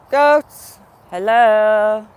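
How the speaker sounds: loud call across the field; calling goats from afar. Real, not acted. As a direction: shout a hello to the goats.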